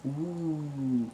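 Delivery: normal talking voice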